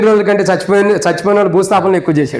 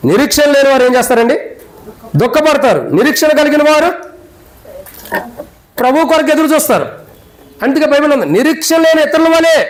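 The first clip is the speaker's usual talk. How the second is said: raised, impassioned pulpit voice, driving each question home; preaching at full force; 9 semitones higher than usual. Acted. Pastor to funeral congregation, preaching at full force.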